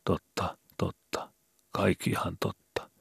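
hollow, hushed whisper; voice as from the grave. A narrator's reading. Vili answers Skobe in a ghostly whisper. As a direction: breathe it out as if from a grave. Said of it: "kuului Vilin ääni kuin haudasta".

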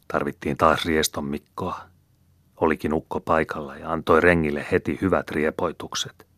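ordinary delivery, nothing marked

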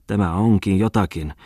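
plain reading voice

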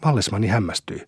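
ordinary delivery

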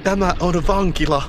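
high, loud outcry; child's desperate high shout; shouted protest. Acted. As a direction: cry it out at the whole class. Child's cry opening the scream scene.

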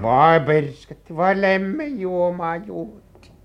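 loud, slow, drawn-out amazement; astonished exclamation. Acted. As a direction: stretch it out in disbelief. Listener reacts to scandalous gossip.

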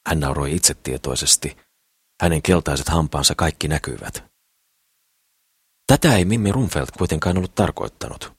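usual speaking voice

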